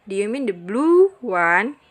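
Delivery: "blue" stretched with a pitch jump; long clear stress on blue. Shown as a demonstration. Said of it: "penekannya itu panjang".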